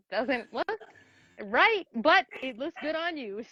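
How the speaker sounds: lightly voiced playful mom; imitating herself to daughter. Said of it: "and I'm like".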